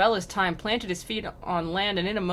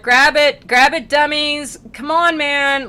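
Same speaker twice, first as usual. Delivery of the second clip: high, loud, drawn-out urging; mock impatient shouting; 7 semitones higher, 10 dB louder, slower than usual. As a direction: call out like a fed-up rescuer. Acted demons rescuing dummies.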